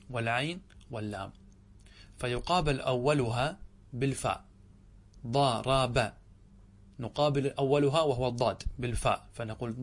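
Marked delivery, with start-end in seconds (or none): 5.3-6.1 syllable by syllable. Shown as a demonstration. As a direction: stretch each syllable of "ضارب" apart. Teacher spells out example for weighing. (تقطيع الكلمة مقطعًا مقطعًا)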